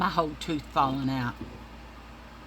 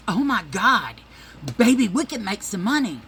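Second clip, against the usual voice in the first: thrilled; 3 semitones higher, 7 dB louder than usual. Retold or imitated. Boyfriend thrilled by money idea.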